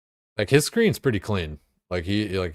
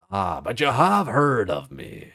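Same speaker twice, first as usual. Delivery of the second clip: slow, higher swaggering pirate; 4 semitones higher, 4 dB louder, slower than usual. Pretend. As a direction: growl it like a cocky pirate captain. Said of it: "I do a really good pirate voice".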